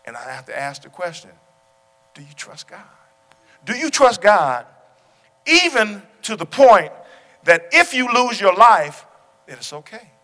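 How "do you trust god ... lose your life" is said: loud, high, slow preaching; fervent sermon climax; preaching at full force. Real, not acted. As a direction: drive each phrase home from the pulpit.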